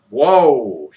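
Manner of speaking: normal talking voice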